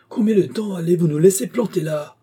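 high, loud, irate demand; angry cry. A narrator's reading. Aviator stranded by the silent worker, angrily demanding. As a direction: shout at him in exasperation. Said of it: "L'aviateur se mit en colère".

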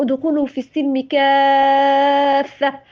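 ادخلوا في السلم كافة recited slowly with a long madd on كافة; slow Quran recitation. Shown as a demonstration. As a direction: chant it slowly, stretching كافة. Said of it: "سورة البقرة". (ترتيل بطيء مع مد كافة)

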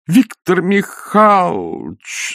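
протяжный испуганный возглас, выше; вскрикнуть имя в испуге, растягивая; испуганный вскрик. A narrator's reading. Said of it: "испуганно вскрикнула". (испуганно вскрикнуть, протяжно)

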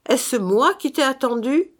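high, rising challenge; insistent interrogation. A narrator's reading. Brother interrogates the dead man, insistent and high. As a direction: press the question like an accuser.

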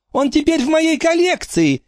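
очень высокий злорадный выкрик; подразни их из укрытия; крикнула. A narrator's reading. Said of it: "крикнула она". (Фрэнки выкрикивает с вызовом)

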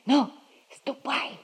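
soft whispered dismissal. Acted. Girl quietly sending the cat away, whispered. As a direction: shoo it off in a whisper.

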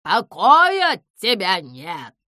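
slow, loud scolding; angry. A narrator's reading. The owl finds Pimpochka in her car, angry. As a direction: snap at the intruder. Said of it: "рассердилась сова".

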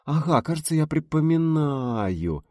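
slow, stretched recollection; lazy drawl of an old man recalling; drawn out. A narrator's reading. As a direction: drag the words out while remembering. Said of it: "затянул Гайдай".

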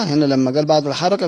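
ordinary delivery

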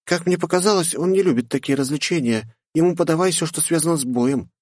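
put-on high, knowing girl voice; young lady voice. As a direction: share an opinion lightly. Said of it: "поделилась своим мнением Присцилла". (высокий голос девушки)

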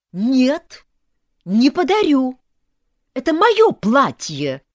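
loud, high-pitched refusal; angry yell. A narrator's reading. Bride defending her own dress, yelling. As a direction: shout it down word by word, furious. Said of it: "заорала я".

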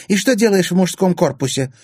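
high, loud accusing question; hostile. A narrator's reading. Woman confronting a girl in the boys' dorm, hostile. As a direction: interrogate her indignantly.

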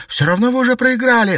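high, loud and fast cry; defiant shout. A narrator's reading. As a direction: fling it back at the witch. Captive girl shouting defiance.

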